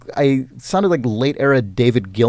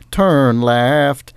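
"Turn left." sung as a little auto-tuned GPS jingle. Retold or imitated. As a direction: do the sat-nav's exact musical phrase. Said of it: "turn left always went".

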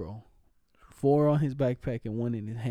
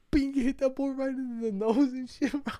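high, laughing through the words; telling it mid-laugh; 11 semitones higher than usual. Real, not acted. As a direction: giggle as you tell it.

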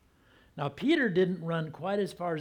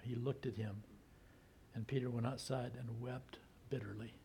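whisper; 4 semitones lower, 13 dB quieter than usual. A narrator's reading. Sermon's sad climax.

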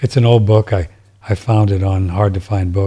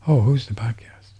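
whisper; 5 dB quieter than usual. Real, not acted. Aside wondering about the podcast.

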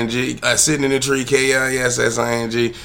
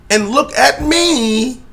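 mocking stare; 13 semitones higher, much slower than usual.

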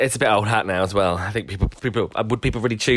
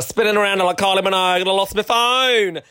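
building excitement; 9 semitones higher than usual. Retold or imitated. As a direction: build it up like a dizzy spin. Mock Kylie spin, rising pitch.

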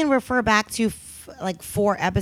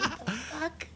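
laughing; 7 semitones higher, 15 dB quieter than usual. Real, not acted. Breathless laugh.

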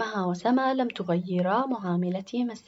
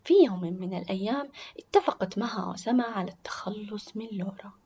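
plain reading voice

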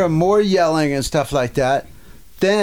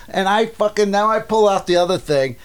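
ordinary delivery

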